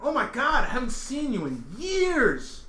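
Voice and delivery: high, loud, drawn-out delight; effusive greeting. Retold or imitated. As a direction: hail a long-lost pal at full volume. Acting someone greeting Pat effusively.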